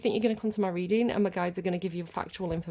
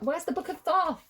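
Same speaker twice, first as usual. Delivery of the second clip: exasperated call; 9 semitones higher than usual. Real, not acted. Searching for lost book.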